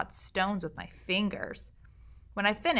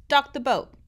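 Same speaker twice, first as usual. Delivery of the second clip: louder, higher shouted order; yell; 6 semitones higher, 6 dB louder than usual. Acted. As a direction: bark the command to dock. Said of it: "I yelled".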